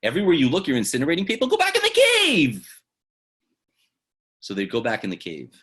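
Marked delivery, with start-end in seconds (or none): 0.0-2.7 building to a high, loud shout; a voice from heaven losing patience; mounting rebuke. Acted. Voice from heaven ordering him back, rising to a shout.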